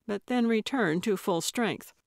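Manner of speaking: ordinary delivery, nothing marked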